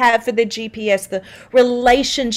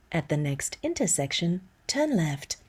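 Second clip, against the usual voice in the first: low, soft navigation prompt, much quieter; GPS voice; 5 semitones lower, 11 dB quieter than usual. Shown as a demonstration. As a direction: read it like a calm sat-nav instruction. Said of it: "they had me say things like".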